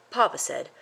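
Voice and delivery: ordinary delivery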